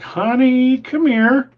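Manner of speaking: high calling out to wife; summoning the wife from the yard, voice lifted. Retold or imitated. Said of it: "I'm like".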